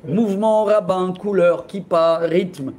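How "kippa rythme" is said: ordinary talk, nothing marked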